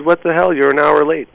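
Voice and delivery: high-pitched, mock-irate telling-off; imitated angry yelling. Irate guest scolds producer over a late call, imitated yelling. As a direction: play the guest going off on you. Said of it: "he started yelling".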